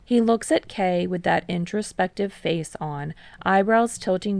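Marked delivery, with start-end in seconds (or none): none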